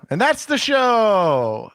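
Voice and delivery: high, loud, drawn-out announcer call; sing-song showman's closer; show sign-off. Acted. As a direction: stretch it out like a big finale.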